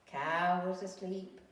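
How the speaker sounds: plain reading voice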